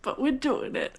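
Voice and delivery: ordinary talk, nothing marked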